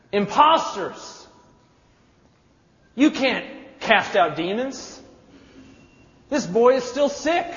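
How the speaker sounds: loud, high jeer, slow and scornful; the crowd crying out at the disciples, loud scorn; mocking, jeering crowd. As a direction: sneer at the failed healers.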